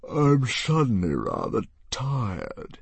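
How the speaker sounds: slow, drowsy dragon voice; fading into sleep; sleepy dragon. Acted. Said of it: "The Green Death yawned".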